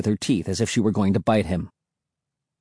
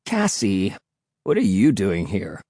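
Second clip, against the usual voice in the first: lower-pitched, mildly demanding question; a touch of demand, pitched down; 4 semitones lower than usual. A narrator's reading. Will challenges Cassie for turning up, mildly demanding. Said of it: "Will demanded".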